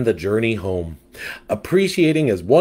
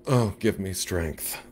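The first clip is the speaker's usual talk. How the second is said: softer, slow exasperated sigh; 6 dB quieter than usual. Acted. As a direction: sigh it out, fed up. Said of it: "sighed the fabulous catfish".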